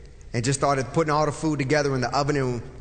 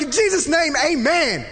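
loud amen; 9 semitones higher, 3 dB louder than usual. Retold or imitated. Closing the prayer loudly.

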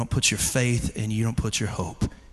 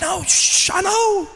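high, urgent shushing; high-pitched placating reply; imitated hushing father. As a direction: hush an excited child while agreeing. Father hushing a loud child.

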